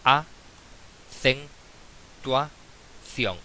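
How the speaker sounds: "a-cen-tua-ción" in four separate syllables with long pauses. Shown as a demonstration. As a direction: split "a-cen-tua-ción" slowly, each syllable standing alone. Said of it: "Si la separamos en sílabas tenemos".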